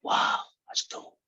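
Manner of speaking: slow, whispered wow; awed little kid. Acted. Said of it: "The little kid went".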